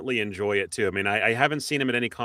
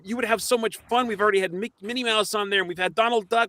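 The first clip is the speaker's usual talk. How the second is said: upbeat, higher hyped-up invitation; eager pitch; 8 semitones higher, 4 dB louder than usual. Pretend. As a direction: sell the podcast with eager enthusiasm. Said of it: "That's what you gotta say".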